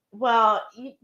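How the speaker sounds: normal talking voice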